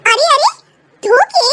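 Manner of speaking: wife halting husband mid-step; calling out. Acted.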